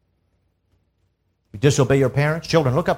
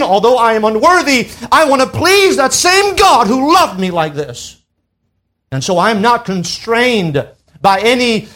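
raised, louder sermon voice; impassioned preaching; 9 semitones higher, 6 dB louder than usual. Acted. Preacher testifying why he wants to please God, impassioned preaching. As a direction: swell into fervent preaching on the key words.

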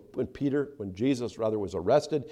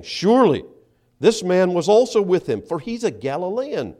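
raised, forceful affirmation; higher and louder, sure of the charge; confident accusation; 6 semitones higher, 7 dB louder than usual. Acted. Bystander identifying Peter as a Galilean, confidently accusing. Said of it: "another confidently affirmed".

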